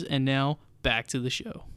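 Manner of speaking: ordinary talk, nothing marked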